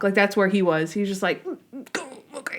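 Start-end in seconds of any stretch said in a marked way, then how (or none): 1.4-2.6 imitating speechless pain, very soft and strained; too pained to talk. Imitating a man who just hit his leg, too pained to talk. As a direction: squeeze out tiny sounds through mock agony. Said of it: "you can't even talk".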